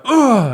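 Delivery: high, loud straining hug grunt; mock bear-hug groan; happy grunt of a hug. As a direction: squeeze out a joyful grunt. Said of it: "he's just like".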